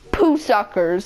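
ordinary delivery

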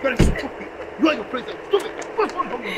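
furious yelling. Acted. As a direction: rage at her, out of control. Man yelling furiously at woman.

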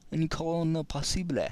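playful mock French accent on "Any corner possible", lifted high; little French accent. Shown as a demonstration. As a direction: say it like a cheeky Frenchman, lightly. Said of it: "saying it in a little French accent".

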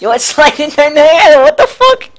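high laughing shriek. Real, not acted. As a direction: squeal with laughter. Sliding down hill, laughing.

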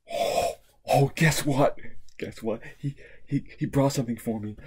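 ordinary talk, nothing marked

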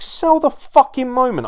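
high, loud demand; exasperated rant. Retold or imitated. Fan berating the commentator for his delivery, exasperated.

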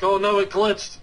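high, loud retold alarm; recalling a moment of panic; panicked surprise. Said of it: "I was like".

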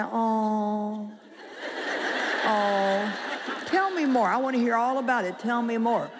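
gently mock a tiny little sweet voice, cooing with sympathy; sweet counselor voice. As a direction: lightly mimic a sweet, sympathetic pastor's wife. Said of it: "this tiny little sweet voice".

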